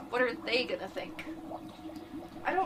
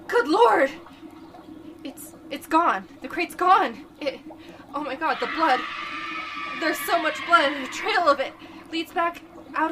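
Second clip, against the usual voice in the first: shocked horror; 5 semitones higher, 8 dB louder than usual. Acted. Finding the blood trail.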